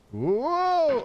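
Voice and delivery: high startled whoa. Real, not acted. Streamer reacts to a slip in game, startled. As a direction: caught off guard.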